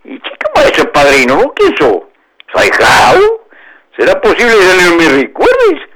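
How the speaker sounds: fox voiced high and eager in mock surprise; high, eager fox voice feigning joy; effusive fox. A narrator's reading. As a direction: greet him like a long-lost friend. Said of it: "exclamó el zorro pidiendo los brazos en efusivo gesto".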